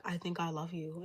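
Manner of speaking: quiet, tender murmured confession; soft love confession. Acted. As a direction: say it softly, almost under the breath. Repeated confession, softer take.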